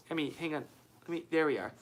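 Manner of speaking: normal talking voice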